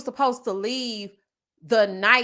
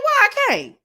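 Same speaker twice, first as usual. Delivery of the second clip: indignant comeback; 13 semitones higher, 7 dB louder than usual. Retold or imitated. Defiant reply to mama.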